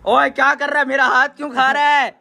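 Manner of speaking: high, loud outcry; startled loud protest. Real, not acted. Feeder yelling at the one biting his hand, high and loud. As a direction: yell it in indignant protest.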